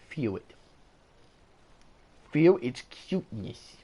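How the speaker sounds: lightly hammed-up reverent coaxing; mock dramatic urging. Urging viewers to feel cuteness.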